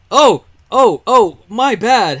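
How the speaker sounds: high, loud startled oh then slow my bad; real surprise. Real, not acted. Chopping a tree with bees by accident, surprised apology. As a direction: catch yourself in a sudden oops.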